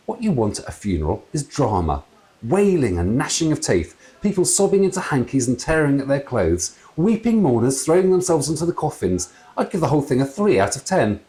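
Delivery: lightly voiced jolly dad; chatty ghost father. A narrator's reading. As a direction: natter on like a jolly old man. Father reviews funeral.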